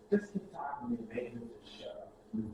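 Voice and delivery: quiet retold threat, barely voiced; whispered warning. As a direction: hiss it close so only he hears. Hushed warning to an inmate.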